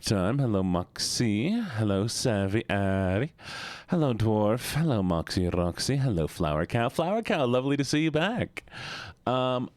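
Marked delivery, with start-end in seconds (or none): none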